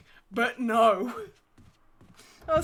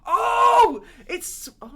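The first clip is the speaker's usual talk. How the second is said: ordinary talk, nothing marked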